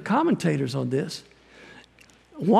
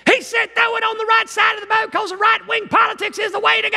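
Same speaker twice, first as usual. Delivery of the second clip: much higher, louder hammed-up preacher shout; mock hillbilly preacher; 5 dB louder than usual. Preacher mocking a hills preacher hollering politics from the pulpit, loud and high. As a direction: ham up a backwoods preacher hollering. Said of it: "somebody has preached this passage like this".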